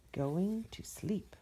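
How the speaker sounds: slow, unvoiced whisper. A narrator's reading. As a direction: breathe the title softly. New story title whispered.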